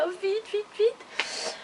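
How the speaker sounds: high, soft little squeal of hurry; mock impatient urging. Customer hurrying her parcel along after ten days, mock impatient.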